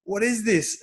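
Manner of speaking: a startled high cry of alarm. Retold or imitated. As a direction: catch the shock as the knee locks. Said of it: "And I'm like".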